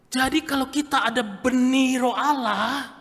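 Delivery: full-force preaching. Acted. Sermon climax.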